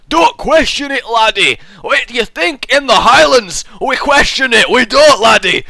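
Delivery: put-on loud, high Scottish accent; hammed-up Highland Scottish. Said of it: "really good for a Scottish accent".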